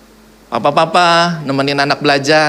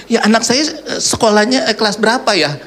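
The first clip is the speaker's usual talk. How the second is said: confused father; 6 semitones higher than usual. Retold or imitated. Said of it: "dia bingung".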